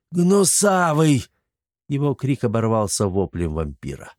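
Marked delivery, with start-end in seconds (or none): 0.1-1.2 higher, drawn-out slow yell. A narrator's reading. Chermes yells at servant as trap springs, slow yell. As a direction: stretch the name out in alarm. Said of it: "выпучил глаза и заорал".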